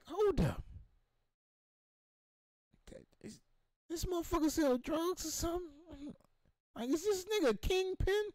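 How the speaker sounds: high, half-whispered suspicion; suspicious. Retold or imitated. Eyeing the man cave, high hushed suspicion. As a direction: wonder aloud in a hush if he's a kingpin. Suspicious about the host's house.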